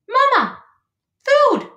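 put-on tiny, very high, loud baby-bird voice; high gosling cry. A narrator's reading. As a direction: call out like a hungry hatchling. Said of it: "said the gosling".

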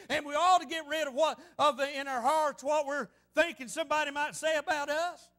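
ordinary delivery

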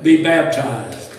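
loud, slow proclamation; ringing, drawn-out declaration; emphatic read-out. Real, not acted. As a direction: punch it out slowly from the pulpit. Reads emphatic line from list.

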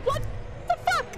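high shocked outcry. Real, not acted. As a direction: yell in disbelief as the cat is lost. Sudden death in game.

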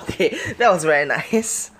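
real amused delight; laughing. Real, not acted. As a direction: chuckle through the praise. Pleased with one shot.

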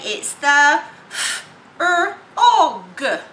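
"f-, og" said slowly in pieces; sounding out slowly. Retold or imitated. Child sounding out frog for teacher. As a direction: stretch the sounds apart. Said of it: "the children might say".

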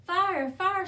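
high, loud alarmed squeak. A narrator's reading. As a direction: squeal the alarm like a tiny frightened animal. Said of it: "squeaked Sugar Glider".

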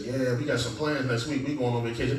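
low, soft parental voice; a hint of a low-key parent announcing plans; lightly voiced parent. Parent telling the children about vacation plans, lightly voiced.

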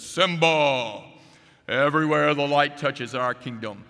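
grand Mufasa-style proclamation; lion father intoning like God over his kingdom. Acted. Mufasa to his son Simba on Pride Rock, voice of God. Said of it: "almost with the voice of God".

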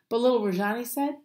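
ordinary delivery

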